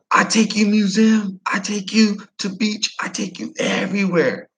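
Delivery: high, eager broken English; imitated Vietnamese English. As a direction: coax the guest to eat. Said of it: "He's like".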